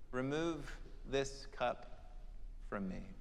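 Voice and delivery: soft, slow pleading prayer; quiet Gethsemane plea; hushed prayer. Retold or imitated. Jesus praying in the garden.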